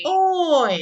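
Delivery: "Oi" called out high and loud; teacher demos the sound loudly. Shown as a demonstration. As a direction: belt out the diphthong. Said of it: "ini dibaca adalah oi".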